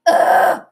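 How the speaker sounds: loud, voiceless ugh; disgusted groan. Real, not acted. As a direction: groan it out hard in frustration. Disgust after the touchdown.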